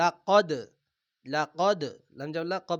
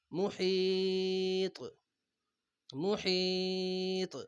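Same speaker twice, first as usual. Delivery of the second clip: slow, drawn-out tajweed chant; Quran recitation; 2 semitones higher, much slower than usual. Acted. Teacher recites the verse slowly for students. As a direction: stretch each vowel like a reciter.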